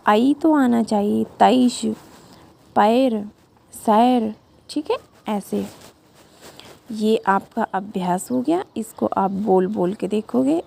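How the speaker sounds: normal talking voice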